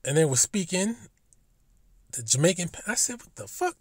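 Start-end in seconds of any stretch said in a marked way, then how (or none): 3.2-3.8 high, hushed "what the fuck" of mock disbelief; retold disbelief. Vlogger recalling finding the Irish video, high hushed disbelief. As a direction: replay the stunned moment, half under breath.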